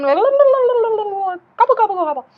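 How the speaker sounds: high, loud gobbling; silly turkey gobble. Pretend.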